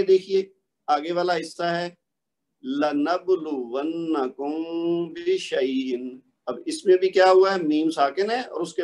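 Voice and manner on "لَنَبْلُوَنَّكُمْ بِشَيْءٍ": slow لَنَبْلُوَنَّكُمْ بِشَيْءٍ with the meem held nasally before the ba; tajweed recitation. Shown as a demonstration. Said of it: "ان کو one by one سب کو پڑھتا ہوں".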